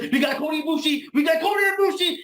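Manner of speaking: high, rapid excited shouting; overexcited kid. Acted. Promoter who just can't wait to reveal, overexcited kid shout. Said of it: "he's like an overexcited kid".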